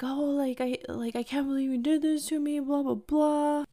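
high-pitched imitation of a wounded boyfriend; mock whining. Imitating the dumped man's protest.

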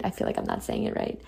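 a quick unvoiced aside; whispered aside. Real, not acted. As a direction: breathe it as a private doubt. Muttered self-doubting aside.

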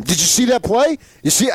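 imitating an upset coach pressing the reporter; retold heated plea, pitched high; animated coach. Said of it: "he really got animated".

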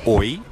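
oi said like English oy, clear and friendly; Portuguese oi. Shown as a demonstration. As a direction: say oi as one rising glide, oy. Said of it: "you can say".

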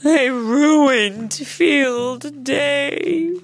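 slow, loud, high wail; drawn-out whiny misery; miserable. A narrator's reading. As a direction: wallow in guilt. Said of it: "Coyote said miserably".

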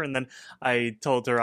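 usual speaking voice

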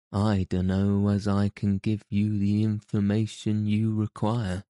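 ordinary delivery

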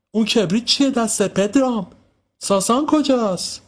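very high, demanding questions; scolding mother wanting answers; suspicious interrogation. Acted. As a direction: grill the children from the window. Suspicious woman questions the children.

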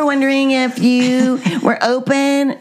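high, sing-song mock receptionist; imitation de Kelly au téléphone. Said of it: "you go like".

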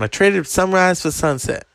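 a hint of [name]'s rasp and swagger; raising the pitch for a loose [name]; [name] impression. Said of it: "he says to Clive Owen".

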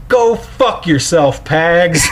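drawn-out retold shout at the catcher; mock shout. Dad at the game telling off Pags, a mild mock yell. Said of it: "Just shouts it".

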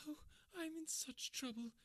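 crying woman. Acted. Kate sobbing on her friend's shoulder, high tearful voice. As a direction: sob it out as Kate in distress. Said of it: "she cried".

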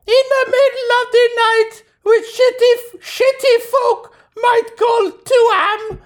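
squeaky put-on Norwegian accent. Shown as a demonstration. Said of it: "do it in Norwegian accent".

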